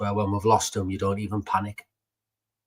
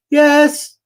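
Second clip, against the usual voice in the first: put-on high-pitched, loud little-kid squeak; little child's voice; 13 dB louder than usual. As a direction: imitate a small child piping up, very high and loud. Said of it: "she said this little voice go".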